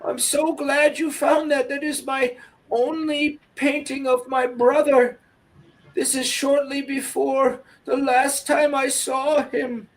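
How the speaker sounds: remember her lost brother with tenderness; tender old lady. Acted. Edith sees the painting.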